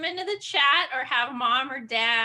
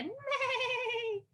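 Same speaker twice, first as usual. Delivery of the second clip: high, soft, slow whinnying neigh; whinny it like a gentle unicorn; horse neigh; 11 semitones higher, 7 dB quieter than usual. Shown as a demonstration. Teacher demonstrates a unicorn's neigh. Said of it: "what kind of noise would a unicorn".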